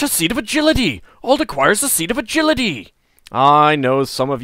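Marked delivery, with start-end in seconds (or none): 0.0-2.9 high, loud item fanfare; excited announcer. Acted. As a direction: announce the find like a prize.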